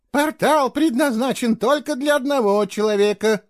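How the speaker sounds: high, emphatic lecturing tone; preachy scolding. A narrator's reading. Girl lecturing Gerik about the portal, preachy. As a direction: lay down the rule like a schoolmarm. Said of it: "нравоучительно сказала она Герику".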